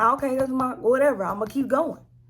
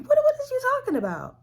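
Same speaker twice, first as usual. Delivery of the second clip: high, fast disbelief; incredulous; 5 dB louder, faster than usual. Retold or imitated. Snapping back at her mother, high and fast. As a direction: rattle it off in shock, much higher and louder.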